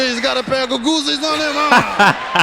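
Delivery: put-on fond gangster pinching the kid's cheeks, Mafia accent. Mobster fusses over the kid, Mafia accent. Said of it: "interesting Mafia accent right there".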